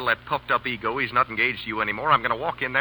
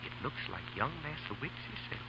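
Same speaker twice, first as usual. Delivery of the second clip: hushed aside; 18 dB quieter than usual. Acted. Hiding from Gerald at night.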